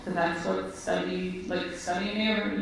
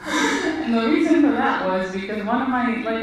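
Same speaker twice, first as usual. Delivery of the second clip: talking through a real laugh, louder; 4 semitones higher, 6 dB louder than usual. Real, not acted. Still laughing as she explains the irony to the interviewer. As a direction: keep laughing as you explain.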